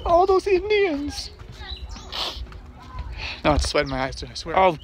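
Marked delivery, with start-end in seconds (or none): 0.0-1.3 high, slow hammed-up sob; fake-weep over the history; mock crying. Dad joking he's crying at the lookout, mock sobbing. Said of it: "because I'm crying".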